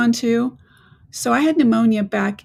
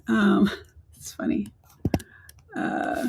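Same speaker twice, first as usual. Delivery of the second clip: whispering; 5 dB quieter, slower than usual. Real, not acted. Quiet aside while fixing links.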